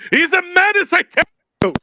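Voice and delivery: high, loud alarmed denunciation; mock outrage. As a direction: warn everyone about the villain, hammed up. Mock outrage at game villain.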